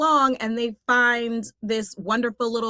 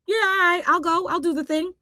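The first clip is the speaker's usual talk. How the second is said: lightly voiced, higher-pitched little character; acted Wisp reply; 8 semitones higher than usual. Wisp agrees to save the colorless land, higher acted voice. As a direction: pitch it up as a willing young heroine. Acting Wisp's eager reply to the voice.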